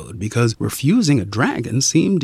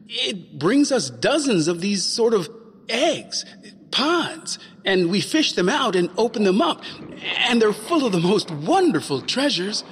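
put-on eager boy; 8 semitones higher than usual. Boy explains to dragon.

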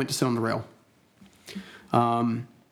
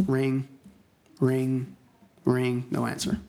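usual speaking voice

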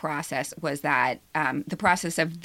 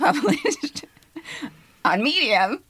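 laughing through higher wry words; 8 semitones higher, slower than usual. Real, not acted. Joking about her own modest publication while laughing. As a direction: laugh at your own small win. Self-mocking joke with laugh.

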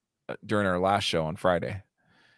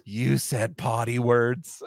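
mock baby talk; much slower than usual. Teasing baby-talk scolding.

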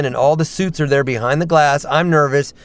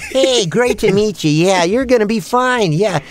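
higher, friendly veteran voice actor, imitated; Billy West; 5 semitones higher than usual. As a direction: greet a newcomer encouragingly in a raised voice. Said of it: "works at, Billy West".

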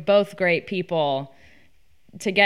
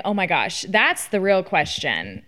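ordinary delivery

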